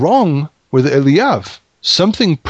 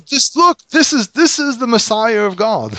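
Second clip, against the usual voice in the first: excited; 9 semitones higher than usual. Retold or imitated. Samuel excited over Eliab.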